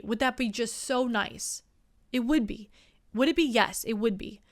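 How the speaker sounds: ordinary delivery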